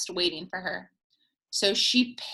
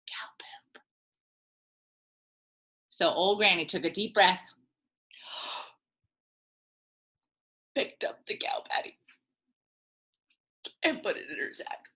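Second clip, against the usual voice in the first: whisper; 4 semitones lower, 4 dB quieter than usual. Real, not acted. Whispered, disgusted.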